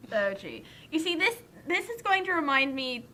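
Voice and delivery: usual speaking voice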